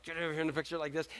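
quick, very soft mock scolding; hushed urgent order. Wife to child at photo time, hushed urgent order. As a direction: order the child over under your breath.

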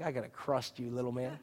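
a hint of dad trash talk; understated gloating dad; mock menacing. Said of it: "you're really like".

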